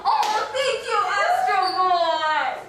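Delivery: high, drawn-out grateful cry; gushing thanks. Acted. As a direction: stretch out the thanks. Grateful skit character.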